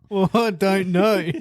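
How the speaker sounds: high-pitched, helpless with laughter; laughing protest. Real, not acted. Podcast rookie stitched up by co-host, protesting through laughter. Cracks up after being put on spot.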